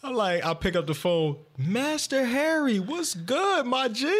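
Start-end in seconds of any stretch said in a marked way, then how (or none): 1.6-4.1 high, slow, overjoyed greeting; excited high-pitched hello; hype greeting. Retold or imitated. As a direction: greet him like a long-lost friend. Greeting the scammer excitedly on phone.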